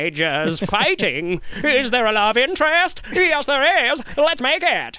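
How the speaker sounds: put-on brash old Hollywood pitch; old-timey producer voice. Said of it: "Old-timey voice".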